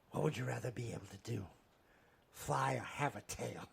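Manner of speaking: low, quiet celebrity impression; Christopher Walken. Retelling a Christopher Walken story, quiet, low impression. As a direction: murmur it in halting Walken cadence. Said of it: "the Christopher Walken story".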